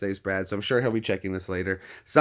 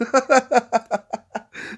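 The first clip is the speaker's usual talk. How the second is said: high, helpless cackle; long high-pitched laughing fit; burst of laughter; 12 semitones higher, 3 dB louder than usual. Real, not acted. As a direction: crack up after holding it in. Cracking up after the prank.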